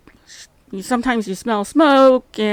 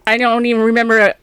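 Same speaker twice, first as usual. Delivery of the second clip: ordinary delivery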